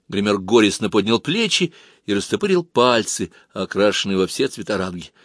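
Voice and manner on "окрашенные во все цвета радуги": usual speaking voice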